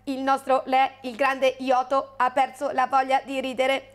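monotone little alien, evenly spaced words; measured alien creature voice; Ioto alien. Acted. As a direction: speak on one steady note like an alien. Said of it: "parla un po' come ... uno Ioto".

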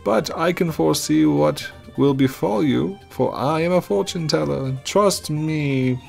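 prophesy doom in a high, dramatic voice; fortune teller voice. Acted.

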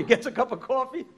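ordinary talk

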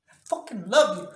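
sudden loud burst on the love declaration; emphatic declaration. Retold or imitated. Woman declaring love to the man she forgave, emphatic declaration. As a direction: break out of the hush with force.

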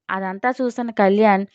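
usual speaking voice

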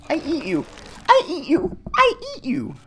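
playful monster chant rising in pitch; climbing mock threat. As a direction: ham it up, pitch rising every time.

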